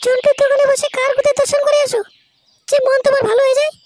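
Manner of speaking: very high, loud, piercing accusation; jealous nagging wife. Acted. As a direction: tear into your husband in a squealing wife voice. Jealous wife confronts husband. (সন্দেহপ্রবণ বউয়ের চড়া গলায় ঝগড়া)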